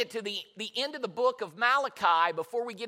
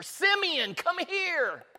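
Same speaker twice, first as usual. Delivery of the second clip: high-pitched, urgent summons; excited acted call; 9 semitones higher, much slower than usual. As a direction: call a friend over from across the temple. Anna calls out to Simeon.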